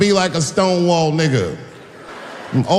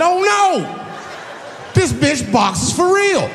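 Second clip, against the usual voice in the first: alarmed realization; 11 semitones higher, slower than usual. Retold or imitated. Comic seeing woman take boxing stance, high alarmed yelp. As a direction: yelp it like you're suddenly in trouble.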